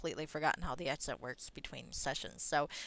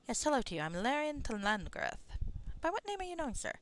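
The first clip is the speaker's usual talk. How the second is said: higher, formal fantasy greeting; a lightly voiced polite adventurer, pitched up; courteous Larian; 7 semitones higher than usual. Larian greets a stranger and asks his name, courteous and higher.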